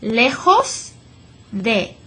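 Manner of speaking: slow "lejos" with a throaty j like h; slow model Spanish. Shown as a demonstration.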